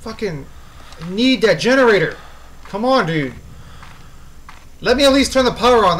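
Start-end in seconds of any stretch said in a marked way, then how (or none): 1.1-6.0 loud, raised-pitch exasperation; frustrated pleading. Real, not acted. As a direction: demand a break from the pursuer. Pleading with game pursuer.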